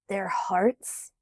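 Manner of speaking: mild scoff. Acted. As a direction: scoff at the notion. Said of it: "he scoffed".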